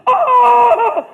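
hammed-up cry of cramp pain; mock pained yell. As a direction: yelp like a cramp just grabbed you. Imitating sudden charley horse pain.

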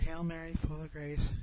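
soft, low murmured rosary prayer; wife quietly at her prayers; praying quietly. Retold or imitated. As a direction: recite it quietly, as in private prayer. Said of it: "Joy's praying the rosary".

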